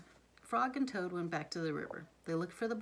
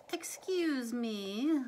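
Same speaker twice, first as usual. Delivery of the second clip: little sparrow voice; 5 semitones higher, 4 dB louder, much slower than usual. Acted. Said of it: "said the sparrow".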